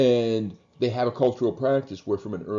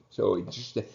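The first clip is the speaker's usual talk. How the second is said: ordinary talk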